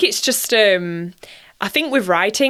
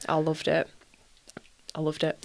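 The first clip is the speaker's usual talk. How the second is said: trailing off into a soft whisper; repeating it dreamily, softer each time; fading whisper; 4 semitones lower, 11 dB quieter than usual. Real, not acted. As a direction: let it die away to a breath.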